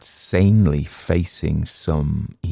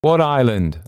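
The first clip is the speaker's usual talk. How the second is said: higher, louder quick question; sudden raised interruption; cutting in; 7 semitones higher, 5 dB louder than usual. A narrator's reading. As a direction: break in on the speaker. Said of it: "I cut in".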